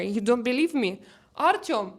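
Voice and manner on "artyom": raised, higher call. Real, not acted. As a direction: call out to someone nearby. Calls out to husband off mic.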